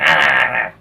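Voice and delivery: sudden loud startled "ah"; imitated jump-scare yell. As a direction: yelp as if the fangs just appeared. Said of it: "you're like".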